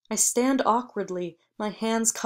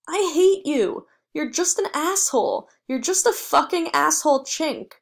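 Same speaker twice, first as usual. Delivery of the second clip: much higher, loud hateful yell; furious scream; 7 semitones higher, 6 dB louder than usual. A narrator's reading. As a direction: scream it at your father. Son screams at his father.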